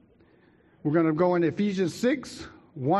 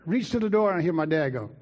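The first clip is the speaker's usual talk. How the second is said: ordinary delivery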